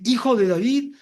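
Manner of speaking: a loud, high cry of Hijo de David; calling out. Retold or imitated. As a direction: cry it out across the crowd. El ciego grita el título. (grito fuerte y agudo)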